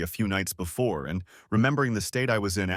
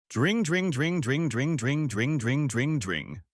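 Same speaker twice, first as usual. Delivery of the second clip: high ringing phone imitation, fading at the end; voiced dring-dring of a telephone; 4 semitones higher, slower than usual. A narrator's reading. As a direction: ring it out like an old telephone. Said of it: "The damn phone greeted me".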